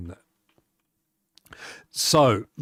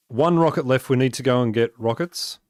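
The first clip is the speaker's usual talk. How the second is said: ordinary delivery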